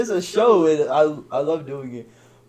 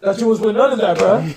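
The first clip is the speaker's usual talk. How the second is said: high, loud mock accusation; teasing call-out; 8 semitones higher, 9 dB louder than usual. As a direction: call out a friend's hypocrisy loudly. Retold teasing call-out of friend.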